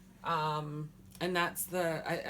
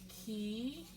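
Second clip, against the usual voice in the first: "Ki, chi", higher and softer, long pause between; sounded out; 4 semitones higher, 11 dB quieter than usual. Shown as a demonstration. Teacher finishing the word for learners, "Ki, chi" sounded out. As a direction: lift each of "Ki, chi" as a separate syllable. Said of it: "So wa, and there's the X".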